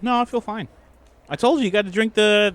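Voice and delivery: normal talking voice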